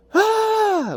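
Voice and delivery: very high, sustained mock scream of rage; frustrated scream. Fed up with begging protesters, a piercing scream. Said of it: "That makes me wanna scream".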